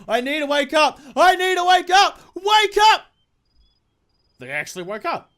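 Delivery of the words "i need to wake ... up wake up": rising from urgent to a full shout; panicked build into a yelled wake up. Acted. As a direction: climb higher and louder until you yell. Trapped in a nightmare, forcing awake.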